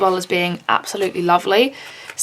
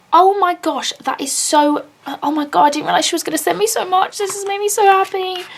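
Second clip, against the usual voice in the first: higher, fast gushing delight; real thrilled gushing; overjoyed; 8 semitones higher than usual. Real, not acted. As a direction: spill over with happy disbelief. Overwhelmed by the gifts.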